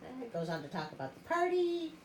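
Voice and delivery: plain reading voice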